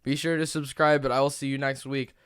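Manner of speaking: upbeat host sign-off; podcast outro. Acted. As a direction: wrap up the show brightly. Episode sign-off.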